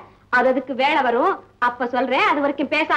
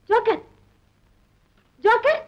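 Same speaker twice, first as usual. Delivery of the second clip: high, carrying call for a missing friend; 9 semitones higher than usual. Acted. Searching the house, calling out for Joker. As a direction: call his name across the house.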